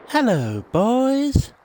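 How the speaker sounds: sing-song hello from a mum at the door; imitating a cartoon mum's greeting; Cartman's mum. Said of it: "Cartman's mum opens the door and she just says".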